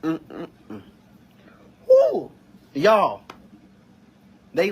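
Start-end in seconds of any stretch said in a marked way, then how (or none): 1.9-2.3 sudden high loud woo; high yelp of disbelief; high loud whoop. Real, not acted. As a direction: let out a whoop of overwhelm. Overwhelmed emotional outburst.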